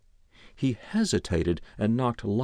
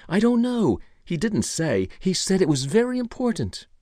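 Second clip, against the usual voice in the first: high-pitched, defensive protest; flustered Ira; 11 semitones higher, 4 dB louder than usual. A narrator's reading. Ira questioned by the detective, flustered. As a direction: protest your ignorance in a high, pressed voice.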